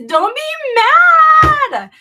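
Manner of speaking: very high, loud, drawn-out whine; mock whine. Crocheter begging chat not to be mad, high mock whine.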